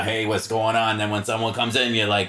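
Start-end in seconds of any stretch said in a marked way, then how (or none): 0.0-1.0 heavy Boston bar greeting, a little louder; a bartender's thick Boston hello; full Boston accent. Shown as a demonstration. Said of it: "if you're normally talking like this behind the bar".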